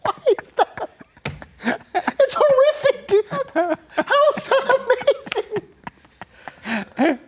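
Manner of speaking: real high-pitched laughing disbelief; laughing protest. Real, not acted. Guest objects to host calling his horror amazing, laughing protest. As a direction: protest through helpless laughter, voice shooting up.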